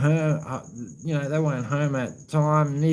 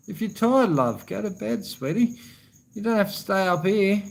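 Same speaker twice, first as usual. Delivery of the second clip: higher, tender voice to a loved one; 7 semitones higher than usual. Real, not acted. Telling his tired partner to go to bed, tender and higher. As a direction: coax her gently off to bed.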